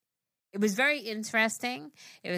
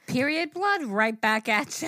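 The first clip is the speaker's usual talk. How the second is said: mock shout; 6 semitones higher, 5 dB louder than usual. Real, not acted. Shouted punchline reaction.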